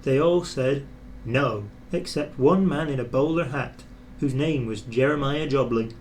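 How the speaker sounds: usual speaking voice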